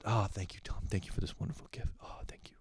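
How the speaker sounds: soft, unvoiced awed gratitude; dad's imagined thanks, whispered and rushed; whispered reverent thanks. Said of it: "My dad comes".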